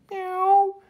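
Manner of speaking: a small, high cat meow; high kitten meow. Acted. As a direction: mew like a little kitten. Kitten sound.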